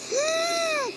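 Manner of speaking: shocked high-pitched gasp; sharp high gasp. Real, not acted. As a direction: suck in air in shock. Gasp at prize.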